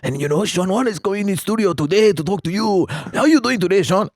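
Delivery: high, loud radio character voice; hyped-up sidekick taking over the studio; Sean Wan. Shown as a demonstration. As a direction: put on a high-pitched, excitable radio sidekick. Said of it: "I would do this guy named Sean Wan".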